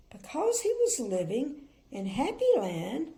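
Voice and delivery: put-on clear, lively storybook reading for children; story narration. A narrator's reading. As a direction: read it like a bedtime picture book.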